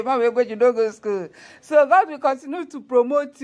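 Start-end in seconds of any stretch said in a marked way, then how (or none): 0.1-1.3 quick mocking echo through laughter; teasing laugh. Real, not acted. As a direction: rattle it off fast, laughing.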